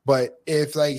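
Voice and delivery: ordinary talk, nothing marked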